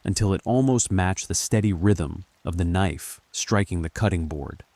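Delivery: plain reading voice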